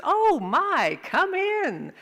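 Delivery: high, loud, swooping welcome; flowery woman. Acted. Flowery woman to family at the door, gushing welcome. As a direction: gush it in a high sing-song. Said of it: "flowery woman in a sweater".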